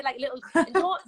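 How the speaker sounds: normal talking voice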